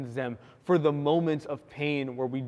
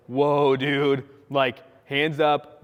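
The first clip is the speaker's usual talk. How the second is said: louder, slow hands-up whoa; startled backpedal; 6 dB louder, much slower than usual. Retold or imitated. Narrator calms an offended roommate, loud startled backpedal. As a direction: back off fast, loud and placating.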